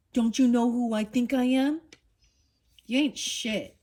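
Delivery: lightly voiced bully showing off; mock bully. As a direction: sneer it like a bragging bully. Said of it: "Is he big bully?".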